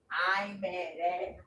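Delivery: ordinary talk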